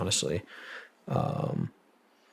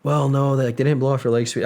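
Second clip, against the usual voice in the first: raised, louder quoted reply; retold reassurance; 6 semitones higher, 6 dB louder than usual. Retelling mother's excited reassurance.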